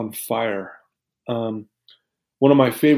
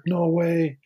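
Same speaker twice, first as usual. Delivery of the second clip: mock amazed, higher and soft; retold freak-out; 5 semitones higher, 4 dB quieter than usual. Little girl freaking out over handfuls of money, mildly imitated. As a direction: imitate a kid's stunned disbelief. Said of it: "she's freaking out".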